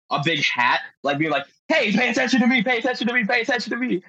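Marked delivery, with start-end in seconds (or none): 1.7-4.0 high-pitched, insistent calling, phrase after phrase; mock attention-grabbing. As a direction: imitate a guy frantically distracting a cobra. Imitates snake distracter demanding attention.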